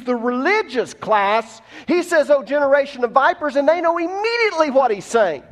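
high, loud sustained sermon cry; fervent pulpit preaching; preaching at full force. Acted. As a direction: drive the point home to the congregation.

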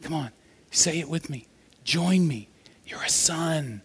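hushed, half-whispered invitation; gentle coaxing. Retold or imitated. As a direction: coax softly, leaving space between phrases. Spirit gently coaxing, acted.

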